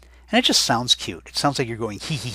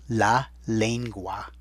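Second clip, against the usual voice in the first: very slow, each vowel of La lengua clear; slow dictation; much slower than usual. Shown as a demonstration. Teacher repeating la lengua slowly so students check vowels. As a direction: stretch out La lengua so every vowel can be heard. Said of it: "I'll say it again. Check your vowels".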